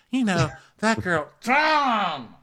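high, loud cry of the name; hammed-up rehearsal yelling Tom; mock exasperated yell. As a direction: blurt it out in a laughing exasperated yell. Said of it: "I'm gonna say".

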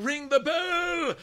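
high, drawn-out mock yell; slow, very high pretend cry; imitated distant yelling. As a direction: stretch it out like a far-away townsperson. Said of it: "people are yelling".

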